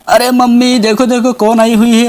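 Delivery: put-on high-pitched child calling for mummy; acted high-voiced kid raising the alarm. Child calls mother on seeing witch.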